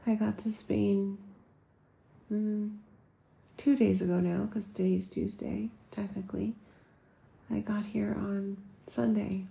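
quiet half-whisper, talking from bed at four in the morning; hushed late-night voice. Real, not acted.

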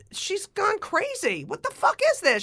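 high-pitched incredulous outburst; exasperated disbelief. Real, not acted. Guest telling hosts how Roseanne changed, exasperated.

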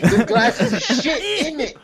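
high, loud mock-British complaint; British accent. Host riffing on British slang, hammed-up British accent. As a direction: belt it out as a hammed-up Brit, half laughing. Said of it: "British accents".

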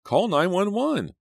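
high, loud, slow urgent call. Acted. Teacher bent over the girl calls for help, high and loud. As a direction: call out for an ambulance.

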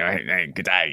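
mock Aussie greeting; Australian accent. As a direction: lay on a broad Australian accent. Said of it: "Keep on the barbie".

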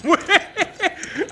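short surprised burst of laughter; delighted laugh. Real, not acted. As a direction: laugh out in amazement. Delighted by the trick.

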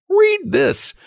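very high, urgent demand; desperate cry. A narrator's reading. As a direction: plead urgently in a piping treble. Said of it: "Benjamin cried desperately".

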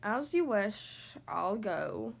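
slow, low creature resignation; resigned breathy monster. A narrator's reading. Rejected monster takes his leave of the child, slow and resigned. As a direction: give in slowly, sinking low.

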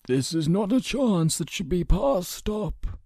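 high, slow, lilting advice; interrupting counsellor. A narrator's reading. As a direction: cut in with measured persuasion. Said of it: "Emerus interrupted".

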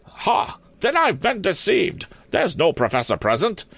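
put-on high-pitched, indignant old maid principal. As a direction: cry it in affronted headmistress tones.

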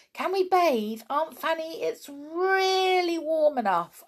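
put-on high, eager child's plea; eager pleading. Children begging Aunt Fanny to let them bathe, eager and high. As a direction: beg to go bathing like an excited kid.

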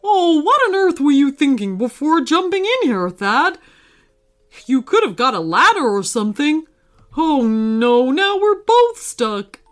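put-on character voice, dismayed; scold, then wail at the mishap; exasperated scolding. Pizza scolds Thad for jumping in, exasperated and dismayed. Stuck friend scolds and laments.

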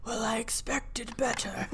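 Voldemort. Retold or imitated. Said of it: "when Voldemort's like".

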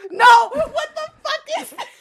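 high, loud, laughing disbelief; real aghast yell, cracking up; shocked shout. Real, not acted. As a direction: burst out in horrified laughter. Reacts aghast to the script.

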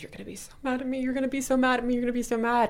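imitating a fretful daughter; daughter worried. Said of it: "She goes".

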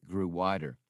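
plain reading voice

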